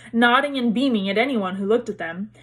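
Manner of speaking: ordinary delivery, nothing marked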